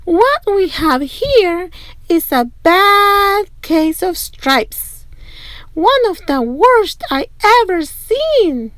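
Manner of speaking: put-on diagnose it like a wise old healer; folksy old woman. A narrator's reading.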